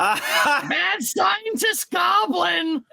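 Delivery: high, slow, shouted mad goblin; cackling mad scientist goblin. Pretend. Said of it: "my usual goblin voice for this".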